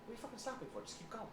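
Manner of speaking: high, sharp coach bark; coach's voice in his head. Retold or imitated. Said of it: "his voice kicks in and goes".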